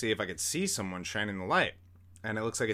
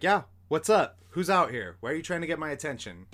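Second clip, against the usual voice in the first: raised, higher-pitched irritated shout; annoyed calling out; 6 semitones higher, 7 dB louder than usual. Retold or imitated. Teller calling to hidden pranksters outside, loud and annoyed. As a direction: call out a challenge, a little annoyed. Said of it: "a little bit annoyed".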